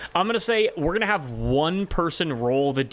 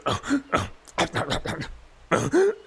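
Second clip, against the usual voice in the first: happy creature noises; slower than usual. Acted. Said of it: "He's, he's happy".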